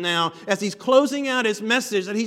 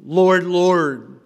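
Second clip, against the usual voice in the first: Lord, Lord called out louder; emphatic vocative; 7 dB louder than usual. Shown as a demonstration. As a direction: cry it out like a plea. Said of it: "very emphatic".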